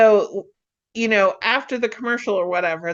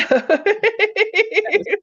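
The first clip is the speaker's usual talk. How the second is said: long high giggle; laughing; 11 semitones higher than usual. Real, not acted. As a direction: burst into high, rippling laughter. Laughs after losing her thread.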